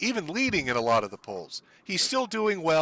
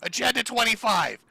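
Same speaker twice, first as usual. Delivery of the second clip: mock Trump scream; 8 semitones higher than usual. Streamer parodying a cartoon Trump with a hammed-up scream. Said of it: "as he screams".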